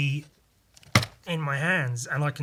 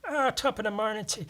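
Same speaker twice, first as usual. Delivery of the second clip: high stage-Irish lilt; cartoonish Irish brogue; Irish accent; 8 semitones higher than usual. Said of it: "what would it be in Irish".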